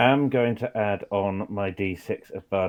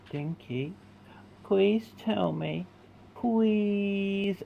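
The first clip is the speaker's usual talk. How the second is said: higher, slow, pleading please; Puss in Boots pleading; 8 semitones higher, 4 dB quieter, much slower than usual. Acted. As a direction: beg with big kitten eyes. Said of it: "make his eyes go full Puss in Boots".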